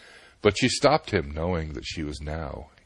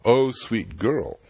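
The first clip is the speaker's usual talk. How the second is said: slow, louder, doting address; smitten sage; 5 semitones higher, 6 dB louder, slower than usual. Acted. Clueless sage dotes on the beautiful girl, slow and smitten. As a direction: linger on it like a lovestruck old man. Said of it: "said the clueless Rishi".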